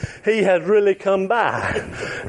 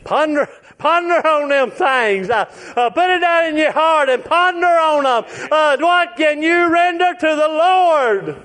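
preaching at full force; 5 semitones higher than usual. Acted. Preacher urging the congregation to ponder, fervent. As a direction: hammer the closing question like a revival preacher. Closing exhortation.

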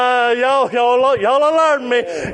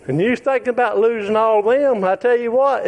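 ordinary talk

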